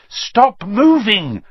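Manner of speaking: high, slow, insistent command; urgent order. A narrator's reading. Hermione orders two boys tangled in the plant, commanding. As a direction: order them firmly, drawing out each word. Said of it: "Hermione ordered them".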